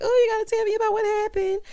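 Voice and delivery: high, loud excited squeal of a quote; all excited. Retold or imitated. Fatima begging her friend for the gossip, all excited. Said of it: "Fatima gets all excited".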